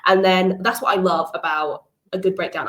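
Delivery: usual speaking voice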